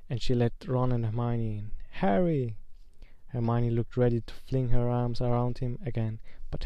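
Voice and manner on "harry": higher exclamation, falling at the end; excited greeting. A narrator's reading. Hermione greets Harry on entering, mildly excited higher call. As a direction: call his name with some excitement.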